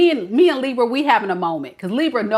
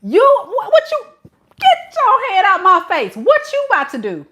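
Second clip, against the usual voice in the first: loud, much higher outraged yelling; Libra's outraged refusal; 10 semitones higher, 7 dB louder than usual. Retold or imitated. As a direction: shout them out of your face. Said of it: "and Libra said".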